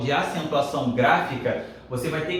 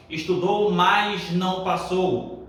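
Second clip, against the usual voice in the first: slow demo sentence; 2 semitones higher, slower than usual. Shown as a demonstration. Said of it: "aí é uma conjunção".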